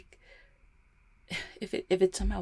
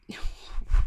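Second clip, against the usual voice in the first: brittle nervous laugh; 7 dB louder than usual. Acted. Forced cheer cracking into panic.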